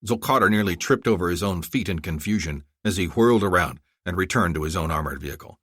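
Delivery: ordinary delivery, nothing marked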